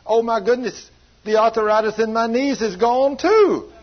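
high, excited mock holler; retold hollering. Preacher retells healed woman rejoicing over her knees, hollering. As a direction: holler it as a jubilant healed woman, drawing out the end. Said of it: "then she started hollering".

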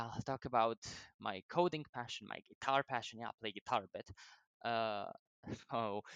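ordinary talk

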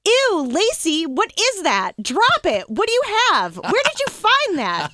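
high, loud, disgusted yelling; disgusted shout. Retold or imitated. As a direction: yell at the dog in disgust. Retold disgusted shout at dog.

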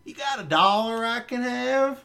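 lightly voiced shameless panhandler; retold brazen request, slowed; mock beggar voice. Shoplifter begging speaker for money.

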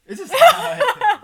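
slow, high laughing echo; mock-repeat through laughter. Real, not acted. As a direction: drag it out while laughing.

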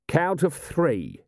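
restrained shout; mild shout. A narrator's reading. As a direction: call out a deadline, drawn out. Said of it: "shouted Vimes".

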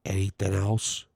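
low, soft, barely audible mumble; real quiet. Retold or imitated. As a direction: say it almost to yourself. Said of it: "Just like that, real quiet".

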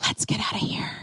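breathy unvoiced whisper. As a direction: whisper it like a secret invitation. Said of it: "she says".

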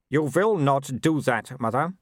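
lightly voiced young werewolf noble, cool; a hint of the arrogant son; Wulf's voice. A narrator's reading. Son answering his mother, lightly voiced young noble.

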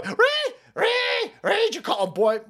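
very high mock outrage squeal; high-pitched hammed-up shriek; mock screech. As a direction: squeal like an offended complainer.

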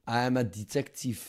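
hammed-up French accent, lightly put on; mock French accent. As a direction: play a pompous French negotiator in a mock accent. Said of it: "Fuck this French accent".

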